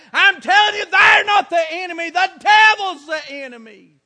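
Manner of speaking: loud, shouted revival preaching; preaching at full force. Acted. Preacher shouting to his congregation about lost souls, fervent. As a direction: hammer it home to the pews.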